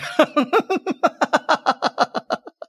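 high wicked witch cackle. Acted. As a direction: cackle like a gleeful hag. Witch laughs after threat.

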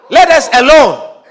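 a demon's loud, strained cry. Acted. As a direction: howl it as the demon being driven out. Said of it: "And he's crying out".

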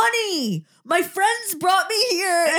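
dopey, high-pitched and slow; high man voice. Retold or imitated. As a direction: play it stoned and giddy. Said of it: "he's like".

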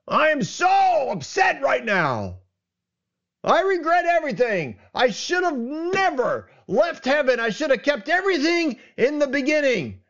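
mock angry God. Preacher playing an angry God regretting creation, hammed-up rant. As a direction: rant like a furious judge throwing down his gavel. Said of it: "The judge who is so angry".

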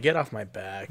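normal talking voice

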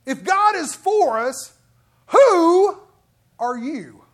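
loud and high, defiant challenge; with attitude. Shown as a demonstration. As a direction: fling the who at them like a dare. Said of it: "Hey, I've got some attitude behind it".

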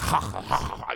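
repeated throaty "kha" noises; mock German gutturals. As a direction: imitate German as throat-clearing syllables. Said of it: "It sounds like".

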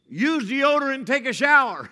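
loud, high punchline; joking emphasis. Shown as a demonstration. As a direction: land the joke with gusto. Joke advice read loudly.

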